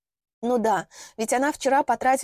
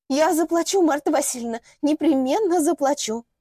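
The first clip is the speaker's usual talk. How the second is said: higher and louder, pleading; pitiful; 6 semitones higher, 4 dB louder than usual. Acted. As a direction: beg with a whimper in the voice. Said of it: "жалостливые нотки в голосе".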